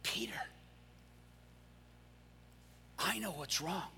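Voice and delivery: Jesus quietly reassures Peter; gentle Jesus. Acted. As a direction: say it kindly, close and calm. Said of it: "Jesus looks at him and he goes".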